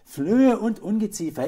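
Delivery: high, very loud warning; alarmed call. A narrator's reading. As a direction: cry it out as an alarmed mother. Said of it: "rief die Frau".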